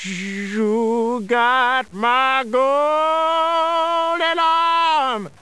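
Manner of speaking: very slow, high drawn-out ghost moan; ghostly wail. Acted. Dead wife's ghost moaning through the wind, very slow and high. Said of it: "he hear it again, closer now".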